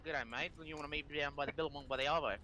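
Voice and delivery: quick, run-together Australian slang; fast Australian. Shown as a demonstration. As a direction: rattle it off like an Australian. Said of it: "Australians talk".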